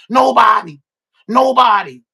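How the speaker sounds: loud shout of nobody; emphatic shout. Real, not acted. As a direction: shout it twice, hammering it home. Bragging about [name]'s solo career.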